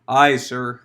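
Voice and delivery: low, loud sheepish reply; a hint of embarrassed clergy. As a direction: answer sheepishly. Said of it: "embarrassedly answered".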